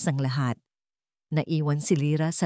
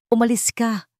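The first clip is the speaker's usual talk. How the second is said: higher, cutting order; sharp; 5 semitones higher, 3 dB louder than usual. A narrator's reading. Ricardo orders Lyra to leave, sharp. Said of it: "Ang mga salita ay kasing talim ng kutsilyo".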